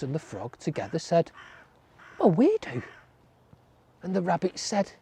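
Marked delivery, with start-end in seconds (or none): 2.2-2.8 high small voices. A narrator's reading. Mouse and frog reply.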